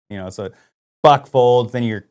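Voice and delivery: ordinary talk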